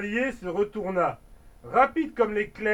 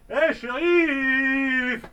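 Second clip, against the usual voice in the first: appel lent; 4 semitones higher, 4 dB louder, much slower than usual. Acted. Cow-boy interpelle le shérif.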